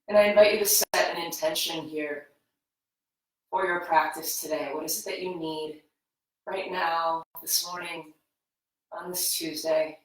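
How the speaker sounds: guided meditation. Acted. Yoga teacher guiding students into breath awareness, soft meditative voice. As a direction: ease them into their breath gently.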